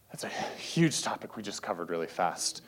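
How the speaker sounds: a hushed, breathy whisper to the crowd; whispered aside. Real, not acted. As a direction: drop to a whisper as if letting them in on it. Aside after rushing a big topic.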